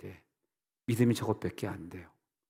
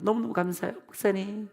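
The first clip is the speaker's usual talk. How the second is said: lightly voiced grateful churchgoer, pitched high; high-pitched mimicked thanks to the pastor; grateful congregant; 10 semitones higher than usual. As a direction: imitate a gushing thank-you.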